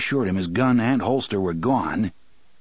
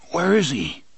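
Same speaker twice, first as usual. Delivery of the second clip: thick, groggy voice; 6 semitones higher than usual. A narrator's reading. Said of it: "O'Meara asked thickly".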